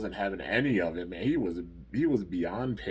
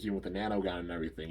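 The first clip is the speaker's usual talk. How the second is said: normal talking voice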